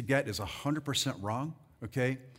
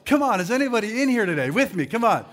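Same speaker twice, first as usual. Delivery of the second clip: loud, higher preacher's shout-out; rallying call; 7 semitones higher, 10 dB louder than usual. Real, not acted. Preacher to congregation asking who is with him, rallying call. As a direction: call out to rouse the room.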